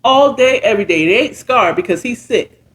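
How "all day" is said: ordinary delivery, nothing marked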